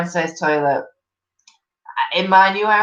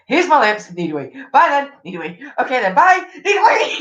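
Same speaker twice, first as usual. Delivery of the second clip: higher, building to a near shout; rushed, increasingly desperate goodbye; mock panic rising to a yelp; frantic goodbye; 7 semitones higher, 3 dB louder than usual.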